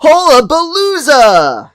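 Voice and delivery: slow, high, smug dad voice; Homer voice. Retold or imitated. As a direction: draw it out like a proud goofy dad. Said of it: "and Homer again".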